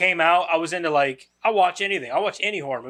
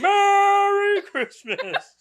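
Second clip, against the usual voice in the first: very slow, much higher, laughing Merry Christmas; Santa Claus voice; 4 dB louder, much slower than usual. Pretend. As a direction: stretch it out, cracking up. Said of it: "Just like that".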